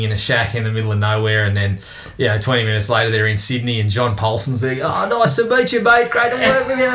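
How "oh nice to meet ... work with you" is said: mock cheery Aussie. Mocking a cheesy movie greeting.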